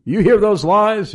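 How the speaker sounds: high, loud, hammed-up alarm; mock warning cry. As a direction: shout it like a preacher spooking the crowd. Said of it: "a sermon telling them".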